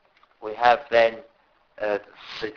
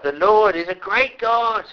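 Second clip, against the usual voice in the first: ordinary delivery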